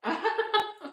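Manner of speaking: a short, high, amused laugh; airy little laugh; light laugh. Real, not acted. Laughs at child not reading.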